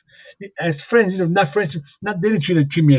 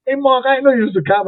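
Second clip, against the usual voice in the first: much higher, playful Hey Mark; teasing gotcha; 9 semitones higher than usual. Retold or imitated. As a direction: friends catching him out, teasing. Friends discovering he's a cop.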